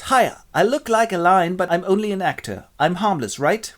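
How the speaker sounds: high, eager amateur player; harmless lion actor. Acted. Amateur lion reassures audience.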